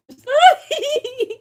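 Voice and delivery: loud, high burst of laughter; high shriek of laughter. Real, not acted. Host cracking up at a viewer's comment, loud high laugh.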